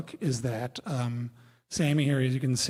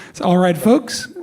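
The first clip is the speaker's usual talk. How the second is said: pilot voice; 5 semitones higher, 9 dB louder than usual. Retold or imitated. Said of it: "this is a pilot voice".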